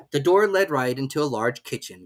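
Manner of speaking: usual speaking voice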